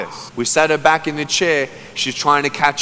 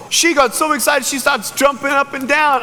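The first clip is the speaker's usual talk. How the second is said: normal talking voice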